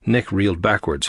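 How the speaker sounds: plain reading voice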